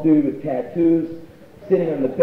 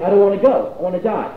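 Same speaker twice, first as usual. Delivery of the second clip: refusing; 8 semitones higher, 7 dB louder than usual. Retold or imitated. Deirdre refusing ambulance.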